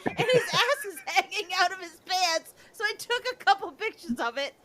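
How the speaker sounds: high-pitched, giggling justification; giggly explanation. Real, not acted. Justifying the photos to Pat, giggly. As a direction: explain it squeakily while cracking up. Keeps explaining while cracking up.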